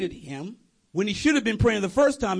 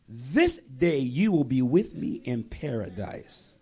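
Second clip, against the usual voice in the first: slow, lower, gentle promise; solemn promise; 8 semitones lower than usual. Acted. Jesus on the cross gently promising the thief paradise.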